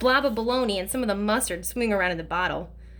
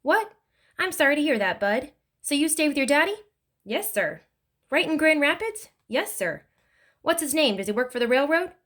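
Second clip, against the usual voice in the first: a touch higher, friendly questioning stranger; lightly voiced kindly older man; 6 semitones higher than usual. A narrator's reading. Older driver quizzing the runaway boy, lightly voiced kindly man. Lefty questions Bud in car.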